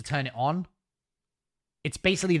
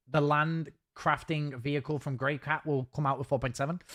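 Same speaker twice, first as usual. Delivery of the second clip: ordinary delivery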